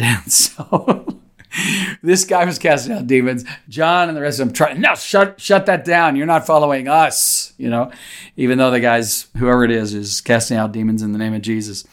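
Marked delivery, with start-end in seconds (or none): 4.7-7.5 high-pitched, mock-bossy objection; indignant mock order. Preacher mimicking John stopping an outsider, high and indignant. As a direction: imitate an indignant disciple shutting someone down.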